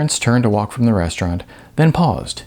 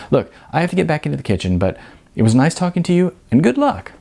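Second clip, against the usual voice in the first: hurried goodbye; 6 semitones higher, faster than usual. Acted. Manager hurries off.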